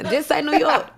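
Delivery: laughing. Real, not acted. Memphis rapper teases New York host about accent, laughing. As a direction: crack up while telling them off.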